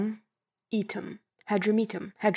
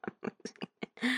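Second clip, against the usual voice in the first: breathless laughing whisper, much softer; 12 dB quieter than usual. Real, not acted. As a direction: lose it laughing quietly.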